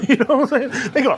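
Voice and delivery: amused, chuckling as you talk; real chuckle in the voice; laughing. Real, not acted. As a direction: laugh through the words. Laughs while telling the story.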